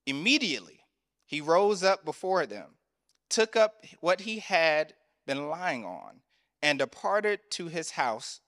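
measured, solemn Bible reading; unhurried scripture reading voice. Acted. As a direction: read the passage slowly to the congregation. Scripture reading before sermon.

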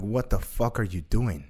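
low, slow inner voice; imitating a stern voice in the head. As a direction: say it slowly and low, weighing each word. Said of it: "another voice came in".

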